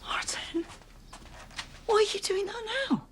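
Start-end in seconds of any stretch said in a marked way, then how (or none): 0.0-0.6 urgent hushed whisper. Acted. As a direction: hiss his name under breath. Hissing at husband to come help.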